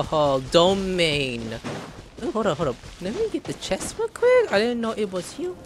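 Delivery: normal talking voice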